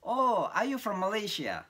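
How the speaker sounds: high, slow, mildly loud mock call; retold shout from the back. A student at the back shouting a guess, slow mock call. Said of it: "Ada yang teriak di belakang".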